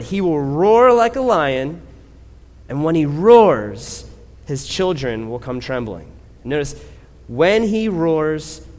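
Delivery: loud, slow, drawn-out preaching with rising pitch; full-force preaching. Acted. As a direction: preach it like a lion roaring. Preaching climax about God roaring.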